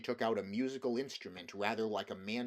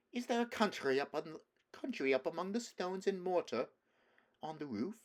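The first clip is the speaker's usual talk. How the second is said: higher, softly amused; kind mocking laugh; 8 semitones higher than usual. A narrator's reading. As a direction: tease him gently. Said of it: "kind, soft, musical laugh".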